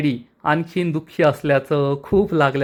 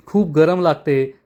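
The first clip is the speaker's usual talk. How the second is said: usual speaking voice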